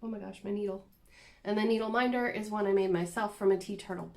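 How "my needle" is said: ordinary talk, nothing marked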